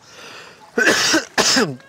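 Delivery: coughing hard on a swallowed bug; loud choking cough. Real, not acted. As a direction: hack loudly at something stuck in the throat. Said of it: "très indigeste un moustique".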